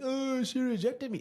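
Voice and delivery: high, drawn-out mock whine; exaggerated self-pitying moan; sulking. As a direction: mimic a sulking rejected guy, slow and high. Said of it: "while you're sitting here sulking".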